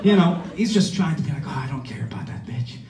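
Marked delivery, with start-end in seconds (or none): 1.5-2.8 soft, offhand muttered imitation of a bragger; mumbled impression of someone talking big; mock rambling trash talk. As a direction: toss off the boasts under your breath. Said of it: "just talking shit".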